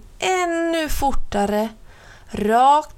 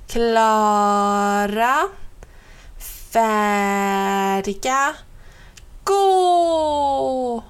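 drawn-out countdown; much slower than usual. A narrator's reading. Little brother counts down slowly before his longest run. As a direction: build suspense before the run.

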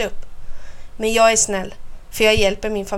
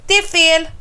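high, raised-voice protest; roared objection; 7 semitones higher, 4 dB louder than usual. A narrator's reading. Snurran rejects Kaxa's tomato claim, hollering. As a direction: holler it like an outraged child. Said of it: "vrålar jag".

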